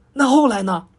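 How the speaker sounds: impatient monkey demanding the rest. A narrator's reading. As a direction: push him to go on. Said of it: "猴子急了".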